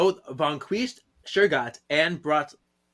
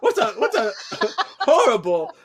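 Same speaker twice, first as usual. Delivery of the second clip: mock partygoer laughing; 9 semitones higher, 6 dB louder than usual.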